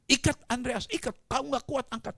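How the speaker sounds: loud, high acted command; shouted retold instruction; urgent order. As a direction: order the crew to tie him fast. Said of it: "Suruh kapten".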